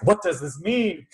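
teacher imitating a worried law-of-attraction fan; mock anxious seeker. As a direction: play the fretful New Age seeker. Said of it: "and you're just like".

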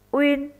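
"Uyên" said as one clear rounded syllable, lips rounded from the start; careful teacher model of "Uyên"; model pronunciation. Shown as a demonstration. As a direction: model "Uyên" slowly and clearly for children to copy. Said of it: "Nghe cô phát âm mẫu".

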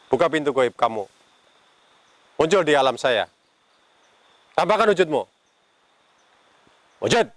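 loud, high commanding call. Real, not acted. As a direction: summon the spirit loud and high. Ghost hunter calling out spirit.

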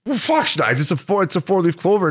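high-pitched defensive retort, lightly acted; mock protest. Retold protest at friend's disapproval.

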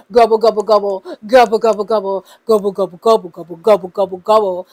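loud playful gobbling like a turkey; turkey gobble. Pretend. Vlogger celebrating the Thanksgiving turkey with loud mock gobbles.

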